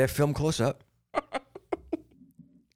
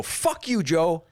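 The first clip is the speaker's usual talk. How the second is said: defiant yell; 9 semitones higher than usual. Pretend. Yelling back at Rogan who called him out, defiant. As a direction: shout back at the challenger, cocky.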